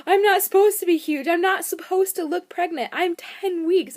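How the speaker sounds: very loud, high mock wail; imitating an upset, hurt outcry; retold tearful outburst. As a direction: protest like a hormonal wife, hammed up. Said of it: "I busted into tears".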